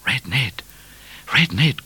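high, urgent appeal without much volume; crying out. A narrator's reading. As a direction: cry out to your horse for help. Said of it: "cried Midnight".

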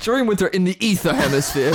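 reading through giggles; laughing. Acted. Jokingly rereads misheard word.

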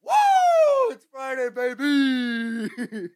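high, drawn-out party cheer; excited whoop. Real, not acted. Podcast host celebrating Friday, excited whoop. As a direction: whoop it out, stretching the last word.

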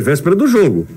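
ordinary talk, nothing marked

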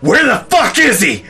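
high, loud voice of an angry woman; mock furious yell. Mom bursting in on the boss, demanding where he is. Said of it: "just hear".

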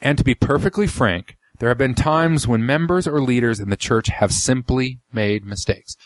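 slow, deliberate reading. Shown as a demonstration. Host rereads a key quote for listeners, slowed down. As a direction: read it slowly so each phrase lands. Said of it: "let me slow down and, and, and say it slow".

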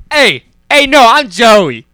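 hammed-up Joey introduction; put-on Joey accent. Correcting a friend's attempt, loud hammed-up Joey accent.

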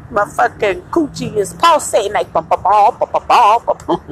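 normal talking voice

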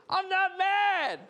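very high, drawn-out mock-furious yell; loud, slow, hammed-up outburst; exploding denial. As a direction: blow up comically while denying it. Final exploding denial in argument.

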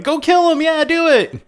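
very high, loud doll cheer; cheering killer doll. Retold or imitated. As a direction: egg it on like a tiny cheerleader. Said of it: "he's like".

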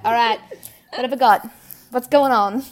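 loud, hammed-up spy checking the room; mock super spy. Woman entering a room like a spy, loud mock voice. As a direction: play a spy sizing up a room, loudly.

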